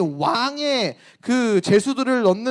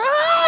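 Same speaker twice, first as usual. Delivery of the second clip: very high staged shriek; mock scream; 5 dB louder than usual. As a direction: let out a fake scream of fright. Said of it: "이러고 소리 지르니까". (과장된 비명 소리)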